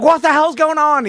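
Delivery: high startled yell; alarmed shout. Retold or imitated. Neighbors shouting at noise.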